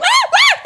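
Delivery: high shrieking ah ah while laughing; mock panicked shriek.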